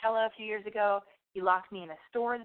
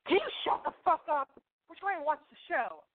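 high, whiny mock scolding; mock uptight snap; 8 semitones higher than usual. Abercrombie people scolding the talkers, high whiny mock. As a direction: play the prissy scold. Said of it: "these Abercrombie people turn around and go".

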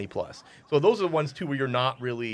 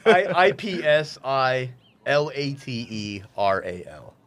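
spelling out; 2 semitones lower, slower than usual. Shown as a demonstration. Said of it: "Spell that first word".

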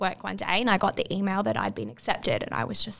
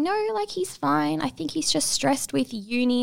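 high nervous cover-up; 7 semitones higher than usual. Retold or imitated. Reassuring his worried family at the wedding, higher nervous denial. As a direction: cover for him with a forced light denial. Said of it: "I'm going".

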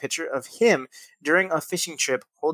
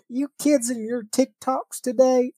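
high, slow cranky geezer voice; grumpy old man; 9 semitones higher, slower than usual. Retold or imitated. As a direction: whine it like a senile old man scolding kids. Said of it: "I sound like those old senile men".

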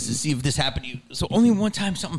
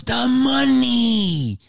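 mugger's demand; 10 semitones higher, 4 dB louder, much slower than usual. Retold or imitated. Mugger repeats demand, retold.

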